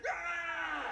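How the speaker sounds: sharp high hammed-up yell; mock scream. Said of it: "right there, when I said".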